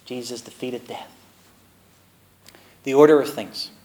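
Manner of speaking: ordinary talk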